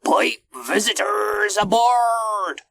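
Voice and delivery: high, slow, loud command; drawn-out captain's holler; captain calling out. A narrator's reading. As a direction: call the order along the deck. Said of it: "called Captain Wilfred".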